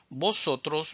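usual speaking voice

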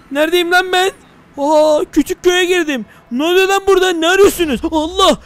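high, loud startled yell; startled shout. Real, not acted. Giant player barges into the tiny village, startled. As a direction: bark the question in shock.

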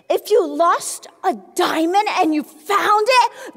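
ordinary talk, nothing marked

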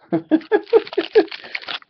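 short high-pitched laugh. Real, not acted. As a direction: burst out laughing. Laughs at the trade offer.

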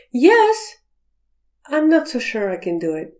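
a yes that means probably not; doubtful yes. Shown as a demonstration. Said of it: "the word yes has about five meanings".

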